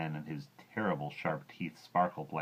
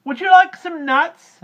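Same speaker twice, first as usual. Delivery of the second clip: very high, loud chirpy monkey; 19 dB louder, slower than usual. Acted. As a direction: chatter cheerily at the croc. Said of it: "asked Mugglewump".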